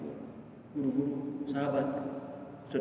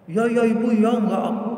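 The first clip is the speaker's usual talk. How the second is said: put-on hurried higher child voice, louder; child promising; 7 semitones higher, 6 dB louder than usual. Children answering mother, mock eager child voice. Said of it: "Gitu anak-anak itu".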